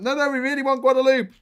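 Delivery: high, loud mock French eagerness; eager French officials. French diplomats to the British, begging for Guadeloupe in a high, loud mock plea. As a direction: squeal it like a desperate diplomat. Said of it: "They're like".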